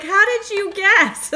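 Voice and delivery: put-on high, loud, drawn-out Rhode Island accent; imitated Rhode Island accent. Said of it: "I know my New England accent".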